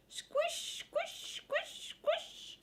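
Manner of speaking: high, breathy whispered squish sounds. Acted. Reading to kids, a whispered squishy footstep. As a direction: whisper the squelch of wet feet.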